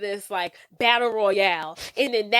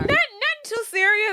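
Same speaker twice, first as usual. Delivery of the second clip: ordinary delivery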